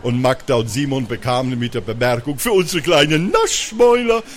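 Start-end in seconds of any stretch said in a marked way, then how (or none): 2.4-4.2 high, singsong hotel welcome; gushing receptionist. A narrator's reading. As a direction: greet regular guests effusively, rising to a held high note. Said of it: "überschwänglich an der Rezeption".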